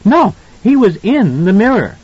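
put-on high, insistent child's voice; emphatic little boy. A narrator's reading. Boy correcting his mother, emphatic. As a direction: insist firmly as a small boy. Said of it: "Danny was very emphatic".

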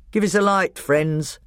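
brisk dwarf request; gruff dwarf. A narrator's reading. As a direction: call out to the badgers like a dwarf. Dwarf voiced in story.

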